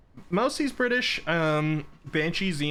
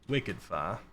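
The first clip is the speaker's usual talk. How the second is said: Boston accent; 4 semitones lower, 4 dB quieter than usual. Shown as a demonstration. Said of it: "one of them Bostonians".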